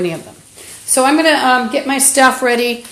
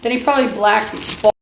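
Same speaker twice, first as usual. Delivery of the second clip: ordinary talk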